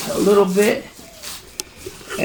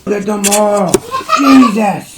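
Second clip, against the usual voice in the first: exasperated disbelief; 5 semitones higher, 6 dB louder, slower than usual. Real, not acted. Packing beads everywhere.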